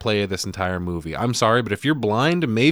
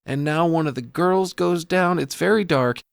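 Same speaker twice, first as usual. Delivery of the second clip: higher, hushed imitation of a movie describer; describing aloud; 5 semitones higher, slower than usual. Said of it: "Liz starts describing it to him".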